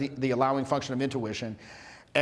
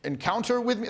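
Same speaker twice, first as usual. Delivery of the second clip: formal introduction; 3 semitones higher than usual. Acted. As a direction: announce yourself with overdone courtesy. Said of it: "if you said it like that".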